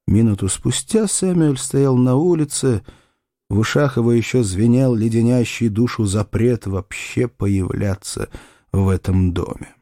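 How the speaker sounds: plain reading voice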